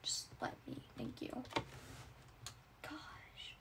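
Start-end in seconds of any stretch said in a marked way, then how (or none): 2.8-3.5 whispered. Acted. Frustrated whisper.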